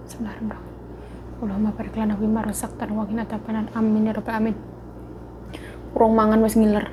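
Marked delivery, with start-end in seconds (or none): 0.1-4.6 softly murmured mealtime prayer. Real, not acted. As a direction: say grace under your breath. Prayer before eating.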